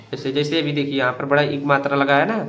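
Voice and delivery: normal talking voice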